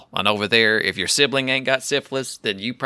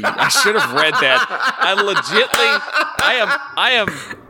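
high, helpless laughter through the words; laughing disbelief; 8 semitones higher than usual. Real, not acted. Host admits not reading the email, laughing in disbelief. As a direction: stammer it out while cracking up.

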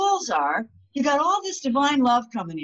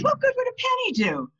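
high, fast, scoffing retelling of the woman; mock doubtful woman; faster than usual. Woman at the seminar, scoffing at a penny, high and quick. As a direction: toss off a disbelieving question. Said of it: "She says".

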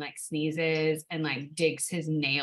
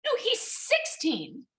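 indignant correction; 14 semitones higher, slower than usual. Real, not acted. Indignant correction of cat's age.

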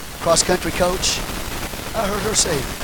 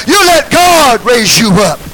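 slow shouted declaration; 5 semitones higher, 6 dB louder, slower than usual. Real, not acted.

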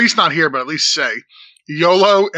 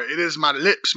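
ordinary talk

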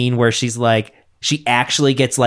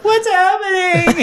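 very high, drawn-out parody whine; mock wail; slower than usual. Stepmom abandoned on the couch, mock bewildered wail. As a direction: wail it like a hammed-up helpless stepmom.

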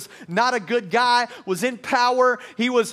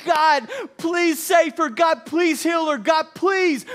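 loud, high, drawn-out begging; acted desperate plea shouted up to God; crying out in prayer; 8 semitones higher, 6 dB louder, slower than usual. Pleading for a dying mom's life, crying out loudly. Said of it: "You would be crying".

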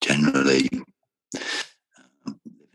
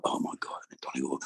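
fast breathless whisper, much softer; stunned whisper; 3 semitones lower, 10 dB quieter, much faster than usual. Retold or imitated. Told an old friend asked him to DJ in Belfast, fast shocked whisper. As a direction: whisper it to yourself in quick disbelief. Recalling being invited to Belfast.

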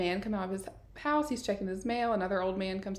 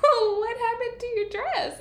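very high, careful mock-sweet question; imitated nervous parent cooing; feigned cheer; 4 dB louder than usual. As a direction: pitch it way up, humouring a scary child. Scared parents feigning cheer to girl.

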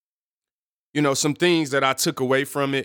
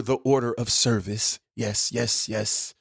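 church voice; 4 semitones lower, slower than usual. Pretend. Podcaster playing pastor to his listeners, church voice. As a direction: slip into a drawn-out church voice. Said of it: "Going to my church voice".

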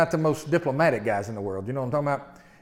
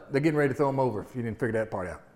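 ordinary delivery

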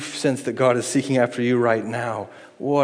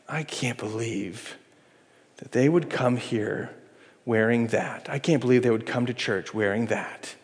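much softer scandalized gossip; judgmental; 3 semitones lower, 6 dB quieter than usual. Retold or imitated. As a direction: quietly tut at the newcomer. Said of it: "We love to judge".

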